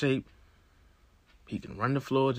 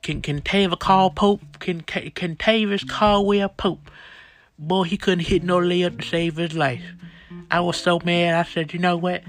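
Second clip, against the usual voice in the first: put-on sassy old church lady voice; Sister Maddie Jenkins; 4 semitones higher, 3 dB louder than usual. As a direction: play a loud, gossipy elderly Lakers fan. Comedy character podcast guest.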